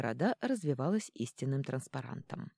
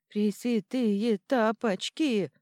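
slow words through chattering teeth; 6 semitones higher, 6 dB louder, much slower than usual. A narrator's reading. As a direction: teeth rattling from the ride. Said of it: "лязгая зубами". (стуча зубами)

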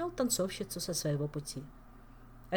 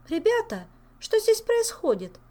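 puzzled; 8 dB louder than usual. Acted. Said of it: "недоумённо вопросила Ольга".

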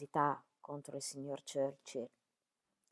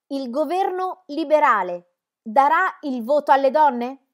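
shouted out loud, high and slow; urlo chiaro; 9 semitones higher, 15 dB louder, slower than usual. A narrator's reading. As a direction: yell the question across a packed hall. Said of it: "urlò con la sua voce dolce e chiara". (urlato a gran voce, lento e acuto)

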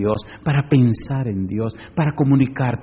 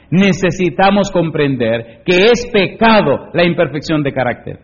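loud, raised voice, key words hit hard; forceful preaching read-aloud; 5 semitones higher, 6 dB louder than usual. Acted. Preacher reads the standard to the congregation, emphatic. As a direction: proclaim the written words to a whole hall.

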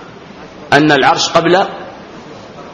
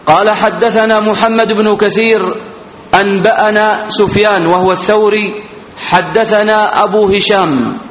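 higher, sustained reading tone; formal hadith reading; 6 semitones higher than usual. Acted. Teacher reads a new chain to students, formal recitation. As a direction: recite the narrators formally on a raised pitch.

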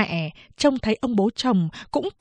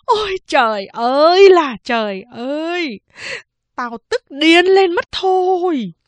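furious wailing; 8 semitones higher, 5 dB louder than usual. A narrator's reading. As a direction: wail it out in fury, stretching the words. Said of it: "hai tay đấm ngực mà gào khóc". (gào khóc tức tối)

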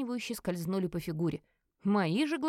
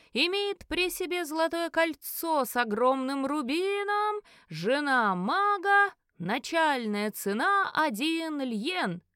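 slow, raised, stretched-out sales call; elf auctioneer; 6 semitones higher, 3 dB louder, much slower than usual. A narrator's reading. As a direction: present the lot to the crowd. Auctioneer lists lot and starting price.

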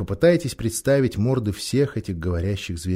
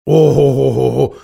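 grumbling bear; 2 semitones higher, 9 dB louder than usual. Acted. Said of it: "проворчал медведь".

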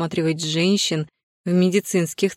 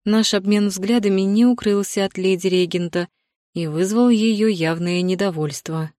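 ordinary delivery

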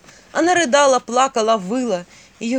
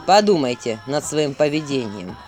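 stern prince voice; 6 semitones lower than usual. Acted.

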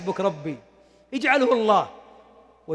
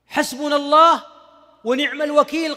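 a firm, louder proclamation of حسبنا الله ونعم الوكيل; proclaiming; 4 semitones higher, 5 dB louder than usual. Acted. Ibrahim facing the fire, firm proclamation. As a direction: call it out with conviction. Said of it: "قالها إبراهيم".

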